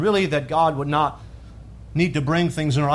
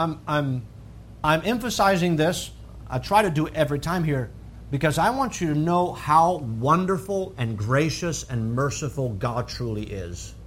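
normal talking voice

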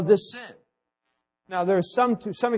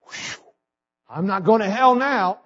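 blown-out whew, then higher and louder; acted relief; 4 semitones higher, 4 dB louder than usual. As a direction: imitate someone relieved at being spared. Acts out relieved new believer.

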